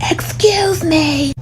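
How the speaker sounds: high, slow, drawn-out "Excuse me"; mock man calling out. Man in line calls after the mother, high and drawn out. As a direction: stretch it out like a prissy stranger.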